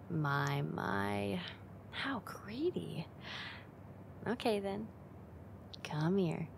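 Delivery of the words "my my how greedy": slow, soft, whispered tease; teasing whisper. Acted. Teasing him for wanting kisses.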